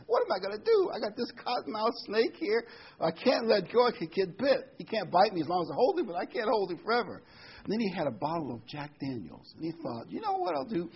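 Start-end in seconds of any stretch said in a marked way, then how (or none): 0.1-7.2 high-pitched comic dilemma voice; storyteller's hammed-up fretting, high; worried fisherman. Acts fisherman stuck holding the snake. 10.1-10.9 high-pitched, hammed-up scheming voice; storyteller playing a man hatching a plan; fisherman's bright idea. Acts fisherman getting an idea.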